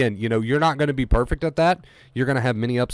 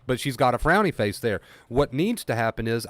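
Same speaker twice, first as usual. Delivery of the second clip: ordinary talk, nothing marked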